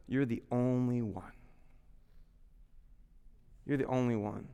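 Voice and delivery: a hint of a sly whisper; soft, hushed voice of shame. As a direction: murmur it like a voice in your head.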